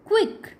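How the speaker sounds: क्विक said high with a clipped "wik"; demo word. Shown as a demonstration. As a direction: model क्विक sharply, high. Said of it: "जैसे क्यू". ("क्विक" ऊँचे सुर में)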